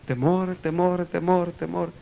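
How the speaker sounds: soft repeats dying away; cave echo. Retold or imitated. Cave repeating the frightened man, imitated echo. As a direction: echo the word, fading out. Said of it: "la cueva contestó el eco".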